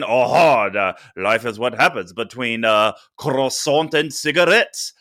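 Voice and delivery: a lightly voiced Frenchman, slow at the end; French accent. Co-host suggesting a better line in a light French accent. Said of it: "maybe it could have been".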